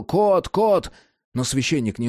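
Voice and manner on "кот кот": higher, urgent repeated call; shouting. A narrator's reading. Adam runs to rescue Cat, shouting. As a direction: call out the name twice in alarm. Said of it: "кричал".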